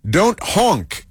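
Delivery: "Don't" jumped up high on an exclamation; exclamatory reading. Retold or imitated. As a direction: read the sticker aloud like an exclamation. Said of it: "exclamation mark".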